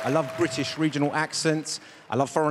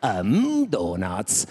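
much lower dopey cartoon voice; Italian Homer; 10 semitones lower, much slower than usual. Comic shows Italian dubbed Homer, very low. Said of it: "had Homer in Italy".

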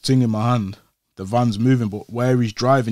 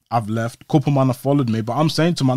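ordinary talk, nothing marked

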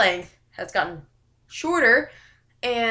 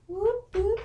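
playful whoops; 10 semitones higher than usual. Pretend. Vlogger in Halloween costume, high playful whoops.

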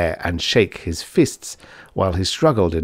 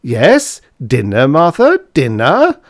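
loud, high, rising at the end; exasperated husband demanding his meal; irritable howl; 6 semitones higher, 8 dB louder, slower than usual. A narrator's reading. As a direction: howl it back impatiently. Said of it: "howled von Hartmann".

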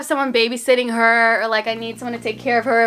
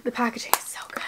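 soft whispered awe; real hushed admiration; 11 dB quieter, faster than usual. Real, not acted. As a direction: breathe the compliment.

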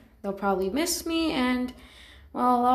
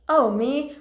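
normal talking voice

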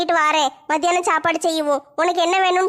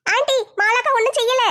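put-on higher, childlike younger sister complaining; whiny kid sister, voice raised high; 6 semitones higher than usual. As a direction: pitch up as the weary little sister.

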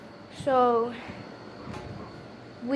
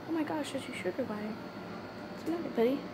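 much softer, fast cooing aside; soft pet talk; 12 dB quieter, faster than usual. Real, not acted. Distracted by the sugar glider, talking softly to it. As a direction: murmur fondly to the little pet.

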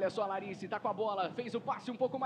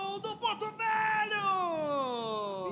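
rising, louder, stretched goal cry; building goal call; 3 semitones higher, 7 dB louder, much slower than usual. Real, not acted.

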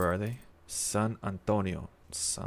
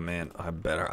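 ordinary delivery

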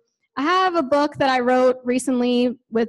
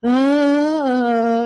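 mopey zombie; much slower than usual. Retold or imitated. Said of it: "walked around like a zombie".

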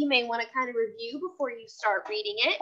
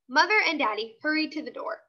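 plain reading voice

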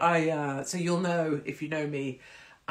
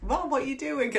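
lightly voiced, higher puzzled kid; a hint of bewildered teenagers; 9 semitones higher than usual. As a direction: play the baffled children. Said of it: "the other kids were like".

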